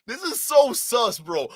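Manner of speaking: high, loud callout to the audience; shouting that he is lying; excited accusing shout. Real, not acted. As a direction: yell it to chat. Accusing friend to chat.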